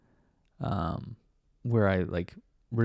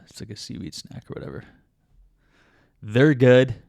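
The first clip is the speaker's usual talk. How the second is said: ordinary delivery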